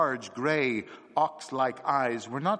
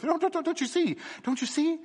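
very high, stammering, feverish voice; desperate to be understood, almost trembling; feverish cry. Acted. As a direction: stumble over the words in feverish haste. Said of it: "he cried feverishly".

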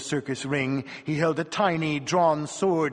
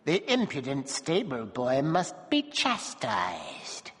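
grim dwarf; slower than usual. A narrator's reading. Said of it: "the dwarf said grimly".

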